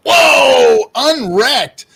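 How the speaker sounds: loud, high whoop; excited whoa. Real, not acted. Streamer greeting a viewer's donation, excited whoop.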